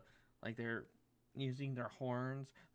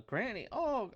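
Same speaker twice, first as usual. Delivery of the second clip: pixie voice; 11 semitones higher, 7 dB louder than usual. Acted.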